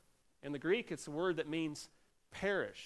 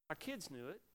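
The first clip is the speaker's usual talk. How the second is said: usual speaking voice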